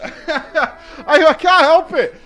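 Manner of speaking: high, loud, laughing outburst; laughing protest. Real, not acted. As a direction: protest helplessly through laughter. Laughing while admitting being annoyed.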